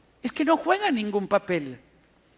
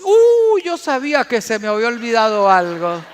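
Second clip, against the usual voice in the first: sheepish husband; 8 dB louder than usual. Retold or imitated. Husband admits to his wife he forgot, flustered and fast. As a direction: caught out and rushing the excuse.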